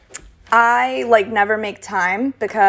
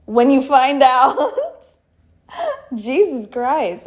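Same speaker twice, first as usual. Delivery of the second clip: slow, laughing through the words; chuckling at the shock; laughing in disbelief; 3 semitones higher, much slower than usual. Real, not acted. Telling friend about the DJ's husband, laughing.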